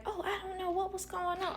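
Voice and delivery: high, fake-innocent voice; mock innocent. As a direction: play dumb sweetly. Mocking parents' feigned ignorance.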